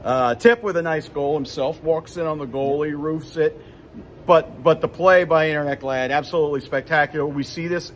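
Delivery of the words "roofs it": ordinary talk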